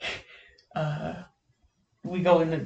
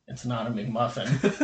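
low deadpan correction; 4 semitones lower than usual. Retold or imitated. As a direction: imitate a friend's deadpan voice, lower. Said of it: "he says it in that super, um, uh, deadpan voice".

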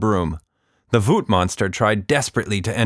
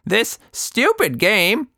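put-on slow, high, cross old woman's voice; furious; 3 dB louder, slower than usual. A narrator's reading. Anita cursing her phone game, slow and high. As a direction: spit out her disgust slowly. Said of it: "Miss Anita was furious".

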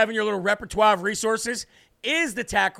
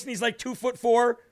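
ordinary delivery

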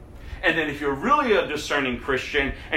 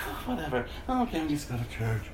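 careless shrug; 3 semitones lower, 6 dB quieter, faster than usual. Retold or imitated. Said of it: "if it's like".

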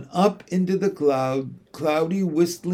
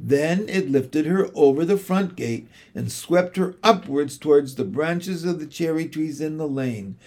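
plain reading voice

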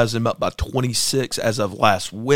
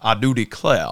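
put-on Southern accent; 4 semitones lower than usual. Pitting one governor against another, mock Southern accent. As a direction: play up a genteel Southern accent a little. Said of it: "who has the more genuine Southern".

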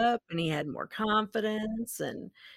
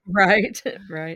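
laughing; 7 dB louder than usual. Real, not acted. Laughing at the joke.

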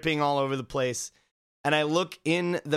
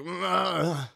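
mock grunt; 4 semitones higher than usual. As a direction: grunt it like a grumpy man. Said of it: "he just kind of grunts".

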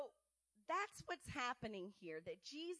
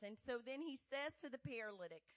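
ordinary talk

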